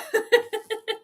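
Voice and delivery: high giggle; real high-pitched laughter. Real, not acted. Laughs at chat.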